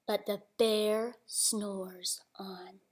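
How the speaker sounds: slow whispered refrain; hushed whisper. A narrator's reading. Reading to a child, slow hushed whisper. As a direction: whisper slowly so he keeps sleeping.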